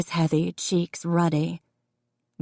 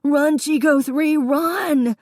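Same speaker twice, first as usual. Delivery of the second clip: much higher, loud panicked wail; hysterical wail; 9 semitones higher, 3 dB louder than usual. A narrator's reading. Davy screaming for his puppy, hysterical. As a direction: cry out in terror for the dog.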